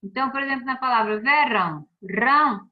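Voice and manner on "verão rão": "verão" loud, then "rão" punched alone; stress on RÃO. Shown as a demonstration. Teacher explaining the tonic syllable, loud stress on "rão". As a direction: hit "rão" in "verão" and echo it loudly. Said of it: "é a sílaba tônica".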